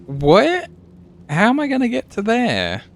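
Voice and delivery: high, startled disbelief; surprised. Real, not acted. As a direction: shoot up in surprise. Sudden puzzled outburst.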